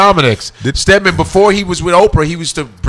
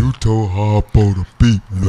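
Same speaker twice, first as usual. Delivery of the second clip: deep voice; 7 semitones lower, 3 dB quieter than usual. Retold or imitated. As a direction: lower Oprah movie voice.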